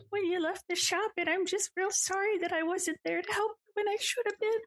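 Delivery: высокий плачущий голос бабушки, на грани слёз; tearful granny. Acted. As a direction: say it high, choking up as she blames herself. Granny breaking into tears.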